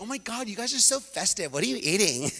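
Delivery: a hint of a bubbly gushing girl; gushing friend. Tammy gushing over the Mexican food, lightly voiced. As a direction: lightly gush like an excited friend. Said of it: "Tammy is like".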